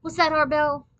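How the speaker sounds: high, loud, excited question; eager question. A narrator's reading. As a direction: jump up and ask eagerly. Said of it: "jumped up and said".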